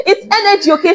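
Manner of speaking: laughing high through the words; bursting with laughter. Real, not acted. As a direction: giggle it out. Laughing after line.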